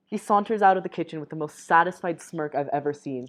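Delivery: ordinary delivery, nothing marked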